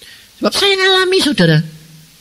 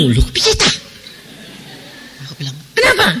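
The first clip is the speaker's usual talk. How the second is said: high, astonished question; surprised; 8 semitones higher, faster than usual. Retold or imitated. Pastor baffled that the woman wants privacy, high and surprised.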